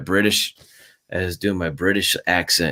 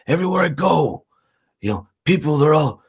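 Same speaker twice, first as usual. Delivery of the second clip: higher put-on wrestler voice, lightly done; imitating Macho Man; 8 semitones higher than usual. Retelling Macho Man talking to Mean Gene, a raised imitation voice. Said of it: "and he's like".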